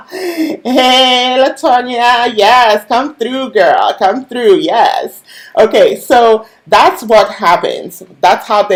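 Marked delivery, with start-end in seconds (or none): 0.1-5.2 excited greeting. Real, not acted. Greeting a viewer joining live.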